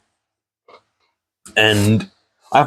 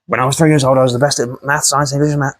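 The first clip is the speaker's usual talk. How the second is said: higher, boastful mock brag; bragging psychopath quote; 5 semitones higher, faster than usual. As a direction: show off like a smug child prodigy. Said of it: "going off like".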